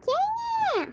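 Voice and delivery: squeaky high little pig; piglet voice. Acted. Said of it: "Aí o porquinho falou". (voz de porquinho)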